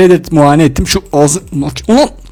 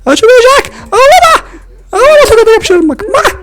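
a tiny tyrant screaming at crying teeth; shrill puppet shouting; high cartoon yelling; 13 semitones higher than usual. Toy dentist yells at teeth.

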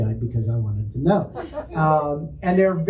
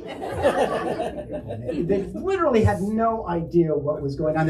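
normal talking voice

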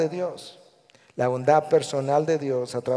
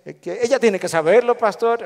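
louder, higher protest to the pastor; insistent husband; 6 semitones higher, 6 dB louder than usual. Acted. Husband answering his pastor's question, insistently. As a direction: push the point as a defensive husband. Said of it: "un esposo dice".

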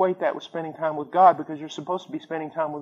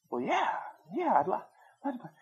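put-on hesitant kid; 4 semitones higher, 10 dB quieter than usual.

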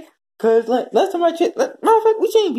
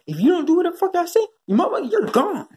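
angry boss; 11 semitones higher than usual. Retold or imitated. Plays an angry team owner.

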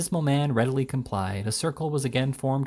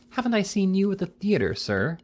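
high-pitched scornful question; 9 semitones higher than usual. A narrator's reading. Lieutenant confronting the stranger at the table, scornful. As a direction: challenge him with lofty scorn. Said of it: "said with considerable scorn".

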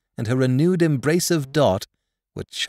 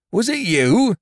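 startled; 10 semitones higher, 6 dB louder than usual. Acted. Carrier recognizing Edward.

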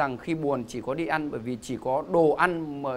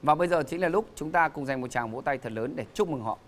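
booming announcer introducing contestants; game show host; 3 dB louder than usual. Acted. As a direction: present them to the studio audience.

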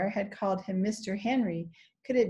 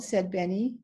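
ordinary delivery

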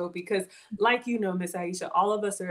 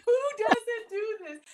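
high-pitched, laughing delight; laughing hard; 5 dB louder than usual. Real, not acted. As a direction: crack up while talking. Host cracks up at delivery interruption.

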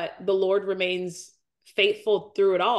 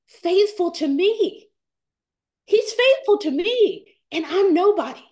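fighting back real tears; on the verge of tears; 9 semitones higher, 5 dB louder than usual. Real, not acted. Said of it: "I'm gonna start crying".

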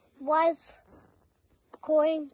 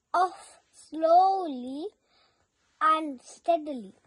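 ordinary delivery

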